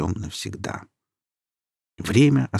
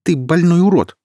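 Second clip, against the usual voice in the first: contemptuous outburst; 10 semitones higher, 7 dB louder than usual. A narrator's reading. Alina scorns her attacker.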